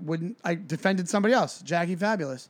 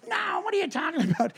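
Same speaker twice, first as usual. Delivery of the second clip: high-pitched mock protest; put-on high woman's voice; mimicked drunk woman; 12 semitones higher than usual. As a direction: imitate her indignant denial, way up high. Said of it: "And she goes".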